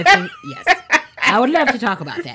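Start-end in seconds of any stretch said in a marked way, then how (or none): 0.0-0.8 high laughing squeal. Real, not acted. Laughing at embarrassing story.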